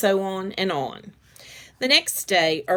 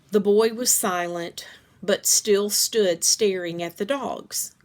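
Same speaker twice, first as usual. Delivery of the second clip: plain reading voice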